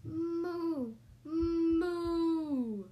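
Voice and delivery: loud, long, high moo; drawn-out storybook cow call. Acted. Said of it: "said the cow".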